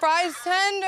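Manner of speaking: loud, high laughing echo; a loud high laughing repeat of the phrase. Real, not acted. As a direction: repeat it cracking up.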